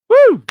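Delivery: loud high whoop; cheering woo. Real, not acted. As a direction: let out a big celebratory whoop. Host cheering guest arrival.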